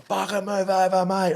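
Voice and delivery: high, harsh mock shout from a rude driver; angry driver yelling. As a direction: bark it like an impatient driver. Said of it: "this guy behind me in an Audi is like".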